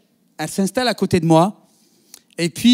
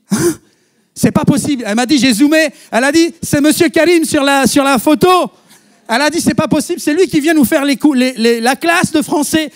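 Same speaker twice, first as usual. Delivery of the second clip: higher, louder amazed retelling; astonished; 7 semitones higher, 5 dB louder than usual. Retold or imitated. Woman recognizing her teacher on the map photo, higher and louder in amazement. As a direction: gasp it out in disbelief.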